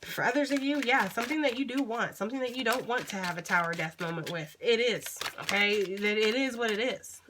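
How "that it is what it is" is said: resigned mantra. Real, not acted. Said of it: "That just needs to be the fucking mantra".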